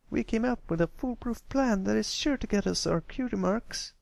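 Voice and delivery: Sweetie Belle's voice. Acted. Filly pitches the foolproof plan to the colt, high sweet voice.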